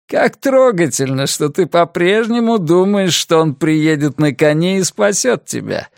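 lightly voiced sneering kidnapper; mock her with cold amusement; gloating villain. Villain taunts his captive.

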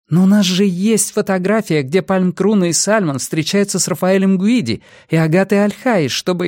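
high-pitched objection, cut short; insistent, pleading pitch until interrupted; agitated protest. A narrator's reading. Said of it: "перебил Карлос".

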